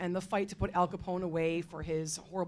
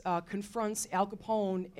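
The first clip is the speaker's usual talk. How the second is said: ordinary talk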